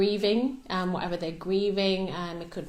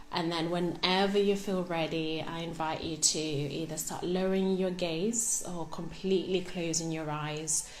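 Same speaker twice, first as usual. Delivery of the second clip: guided meditation; 6 dB quieter than usual. Real, not acted. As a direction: guide them gently through the breathing, softly and unhurried. Leads a breathing meditation.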